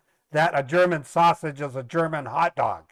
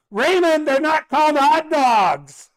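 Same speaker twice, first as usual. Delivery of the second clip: much higher and louder, slow correction; exasperated brother; 13 semitones higher, 8 dB louder, slower than usual. Retold or imitated. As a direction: scold a cheeky sibling.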